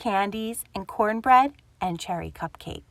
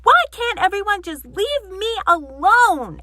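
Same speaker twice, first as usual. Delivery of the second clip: angry shout, higher and much louder; 10 semitones higher, 9 dB louder than usual. Acted. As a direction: bellow it at the door. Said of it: "shouted Grumpy Badger".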